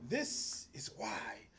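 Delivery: slow hushed whisper. Real, not acted. Admitting to viewers why he's an asshole, whispered. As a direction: drop to a whisper like a confession.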